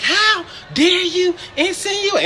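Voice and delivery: high-pitched outraged customer impression; mock indignant yelling. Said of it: "yell at me over the phone".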